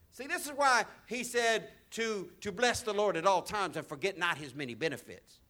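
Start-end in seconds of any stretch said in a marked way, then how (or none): none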